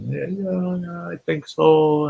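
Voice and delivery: put-on high, small, tearful little-girl voice; imitated crying girl. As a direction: mimic a kid answering through tears. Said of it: "still crying hard".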